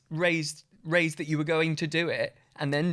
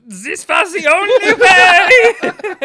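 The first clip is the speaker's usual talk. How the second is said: high, loud, slow dramatic yell; dramatic shout; 14 semitones higher, 15 dB louder, much slower than usual. Retold or imitated. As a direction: belt it out like a melodrama hero. Quoting actor's dramatic line.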